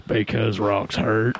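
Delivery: low, slow dopey answer; dimwitted sibling. Retold or imitated. Sibling answering why they throw rocks, dimwitted. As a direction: drag it out low like a dim kid.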